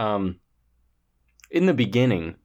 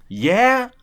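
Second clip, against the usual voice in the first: high, loud rising yell; mock shouted reply; 6 dB louder than usual. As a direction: call it back high and questioning. Imitating Dean answering the farmer.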